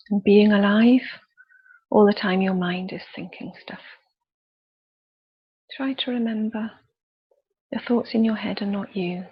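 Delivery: soothing meditation voice; guided meditation. Acted. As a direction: lead them gently through the body, unhurried. Leads a guided body scan.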